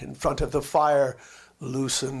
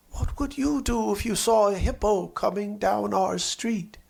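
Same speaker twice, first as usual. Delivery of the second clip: lightly voiced eager boy, slightly higher; a hint of an excited child; child's question; 5 semitones higher than usual. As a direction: ask it like a curious small boy. Child asks friends a question.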